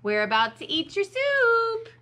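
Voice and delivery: high, loud teasing holler; calling out. A narrator's reading. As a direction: call it through the house. Dakota joins in calling Max.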